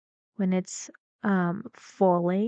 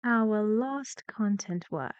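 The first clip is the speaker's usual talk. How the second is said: ordinary talk, nothing marked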